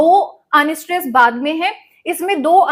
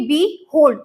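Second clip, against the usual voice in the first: behold with the energy on the last syllable, "hold"; stress on second syllable. Shown as a demonstration. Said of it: "last वाले में energy ज्यादा जा रही है".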